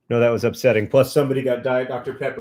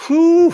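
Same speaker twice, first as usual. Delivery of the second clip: loud whew; 10 dB louder than usual. Real, not acted. Exhale of relief.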